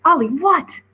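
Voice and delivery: sudden high loud snap; Brian snaps awake. A narrator's reading. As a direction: jolt awake and bark the word. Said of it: "snapped Brian".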